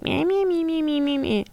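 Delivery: high mocking "me me me"; mocking whine. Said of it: "I'm just like".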